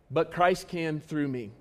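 usual speaking voice

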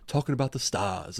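genteel plantation Southern accent, R dropped in stars; high class Southern accent. Shown as a demonstration. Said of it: "that nice high class Southern accent where you're, like".

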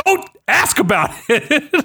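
high, laughing don't-ask; mock exasperated, laughing; laughing brush-off. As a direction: wave it off through a laugh. Exasperated reply to wife, laughing.

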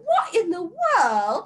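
mock it in exaggerated British; mocking British accent. Everybody mocking Ryder's formal phrase, exaggerated British accent. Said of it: "mock them in a British accent".

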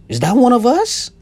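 hammed-up hopeful who-is-one-of-us voice; imitating Irish people spotting a redhead celebrity, mock eager; mock excited Irish people. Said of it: "you think Irish people be like".

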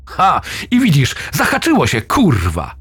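high, excited yell of success; yelling in triumph as the stick hooks the branch; triumphant shout. Acted. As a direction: shout it out as the rope finally catches. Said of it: "wykrzyczał".

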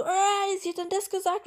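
high mock shout; mock yelling across room. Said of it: "schreien die sich übers Wohnzimmer an". (nachgeahmtes Quer-durchs-Zimmer-Schreien, hoch)

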